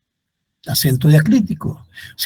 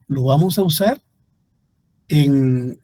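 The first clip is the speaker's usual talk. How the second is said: normal talking voice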